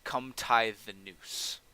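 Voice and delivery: drawn-out sinister offer; slow menacing order. Acted. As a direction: lord ordering prisoner to tie noose, slow. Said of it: "he looks to you and he goes".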